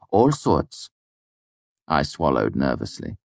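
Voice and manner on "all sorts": breezy, dismissive tossed-off reply; flippant. A narrator's reading. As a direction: toss it off lightly. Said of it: "he said flippantly".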